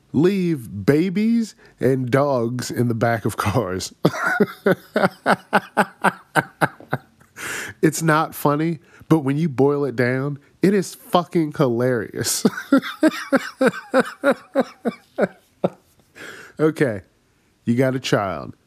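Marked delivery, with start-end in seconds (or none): 4.0-6.1 high cackle. Real, not acted. Laughs at own joke. 12.5-14.6 high cackle. Real, not acted. Laughs at own joke.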